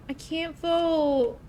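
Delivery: high, slow, drawn-out whine; whiny protest. Real, not acted. Streamer shut out of the poll, in a high drawn-out whine. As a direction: whine it, stretching out VOTE. Realizes they cannot join the poll.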